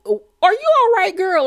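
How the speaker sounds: very high, squeaky check-in; mock concern. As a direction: imitate a baffled friend, pitch shooting up. Imitating reaction to overdone outburst.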